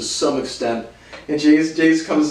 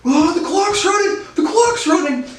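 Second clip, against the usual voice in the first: very high, hammed-up panic; squealed mock alarm from the stands; mock parents yelling; 9 semitones higher than usual. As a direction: imitate worked-up parents in a high voice. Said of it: "they're yelling at me".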